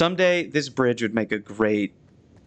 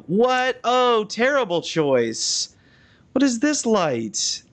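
higher, slow, appalled questions; dismayed surprise; 9 semitones higher, slower than usual. Real, not acted. Judging a building's lighting for viewers, slow appalled surprise. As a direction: recoil at an ugly design choice. Reacting to bad lighting choice.

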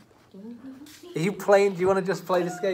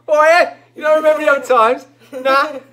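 loud, high exaggerated cockney; brash, quickfire cockney send-up; mock cockney; 10 semitones higher, 14 dB louder than usual. Director teasing the actress's reading, loud mock cockney. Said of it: "he just went all cockney".

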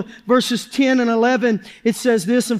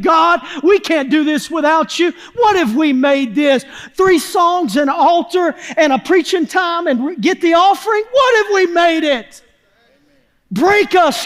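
high-pitched preaching cry, climbing as it goes; impassioned plea; 4 semitones higher than usual. Acted. Preacher cries to God on behalf of the church, impassioned. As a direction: plead upward to God with mounting urgency.

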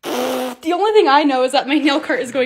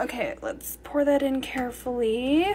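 careful hush; 3 semitones lower, 10 dB quieter than usual. Real, not acted. Pouring hot sugar carefully.

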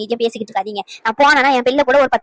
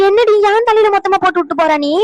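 indignant protest; 6 semitones higher, 3 dB louder than usual. Acted. Grandmother protests being left the burden.

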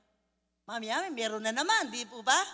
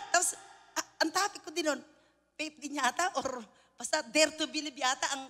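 normal talking voice

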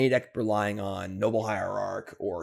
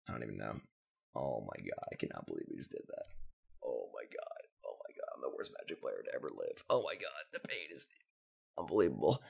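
much lower, softer groan of defeat; groaning despair; 10 semitones lower, 9 dB quieter than usual. Real, not acted. Gamer crushed after blowing the game, low groan.

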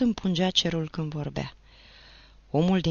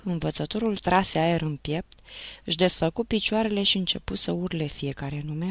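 usual speaking voice

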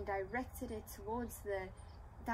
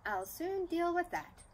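high, loud fairy declaration; declared; 9 semitones higher, 6 dB louder than usual. Acted. Fairy promising Dinarella help, high loud declaration. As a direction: announce it like a bright fairy. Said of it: "declared Fairy Dactyl".

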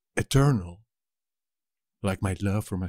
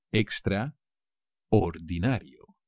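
slow model word; slower than usual. Shown as a demonstration.

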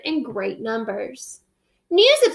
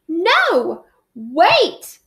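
crying out; 5 semitones higher, 3 dB louder than usual. Acted. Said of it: "the queen cried".

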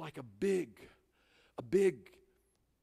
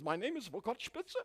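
put-on German accent; 6 semitones higher, 5 dB quieter than usual. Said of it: "I heard this German boy say".

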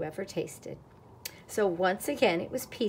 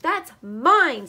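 high, loud indignant squeak; 7 semitones higher, 9 dB louder than usual. A narrator's reading. Squirrel claiming the spoon from duck, squeaked. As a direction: squeak it out possessively. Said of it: "squeaked the squirrel".

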